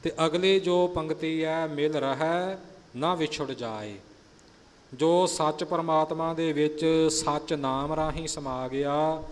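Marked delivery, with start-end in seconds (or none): 1.7-3.9 ਮਿਲ ਰਹਿ, short break, then ਨਾ ਵਿਛੁੜ ਜਾਏ; half pause after ਰਹਿ. Shown as a demonstration. Teacher marking the half pause for the sangat. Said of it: "ਜਮਕ ਦੀ ਭਾਵ ਅਰਧ ਵਿਸਰਾਮ".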